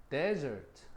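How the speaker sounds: desert with the DE stressed; stress on first syllable. Shown as a demonstration. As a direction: lean on the front of desert. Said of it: "강세가 앞에가 있지?". (desert 첫 음절 강세)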